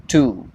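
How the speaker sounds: "टू" with long oo; stretched oo. Shown as a demonstration. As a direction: hold the oo in too. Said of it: "इसका pronunciation है टू". ("टू" में लंबा ऊ)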